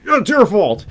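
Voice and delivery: high, mock-indignant yell; mock scream. Imitating the president screaming in his speech, mock yell. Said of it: "he starts screaming".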